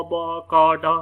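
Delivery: बा, का, डा sounded out loud and clipped, one by one; school-style letter sounds. Shown as a demonstration. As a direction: call out बा, का, डा like a phonics drill. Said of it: "इस तरह से पढ़ाते नज़र आएंगे". (स्कूल वाले अंदाज़ में बा, का, डा ज़ोर से बोलना)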